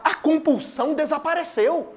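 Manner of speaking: ordinary delivery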